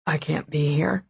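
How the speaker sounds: hoarse man's voice. A narrator's reading. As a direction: rasp it out, strained. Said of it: "he said hoarsely".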